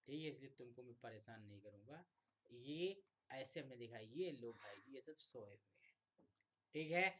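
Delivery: ordinary talk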